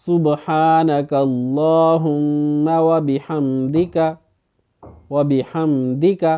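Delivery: وبحمدک slow, with a short zer on the dal; correct recitation. Shown as a demonstration. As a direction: recite وبحمدک slowly, no long vowel on the dal. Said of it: "کس طرح پڑھنا ہے؟".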